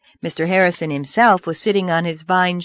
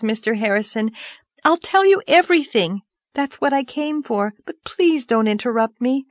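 put-on earnest high girlish voice; 7 semitones higher than usual. Anne pleading.

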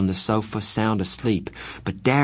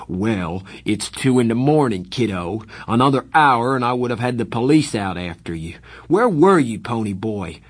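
louder angry scolding, peaking on where; 2 semitones higher, 4 dB louder than usual. Acted. Darry scolding Pony for coming home late, angry. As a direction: let the anger climb. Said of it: "He was madder than I'd seen him".

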